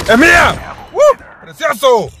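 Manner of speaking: high whoop and proud cry; victorious gamer shout; triumphant cheer. Real, not acted. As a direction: crow over the win. Celebrates winning the round.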